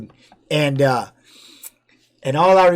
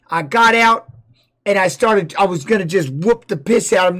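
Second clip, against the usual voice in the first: ordinary talk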